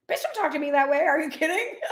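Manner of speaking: high, loud mock telling-off; mock scolding. Mom snapping at her sassy son, mock outrage.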